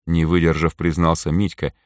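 plain reading voice

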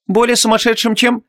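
high-pitched doubting question; skeptical. A narrator's reading. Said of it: "скептически хмыкнула Ванесса".

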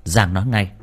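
plain reading voice

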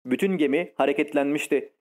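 ordinary delivery

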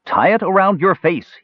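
calling over fire. A narrator's reading. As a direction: call out above the flames. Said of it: "he called over the roar of the encroaching fire".

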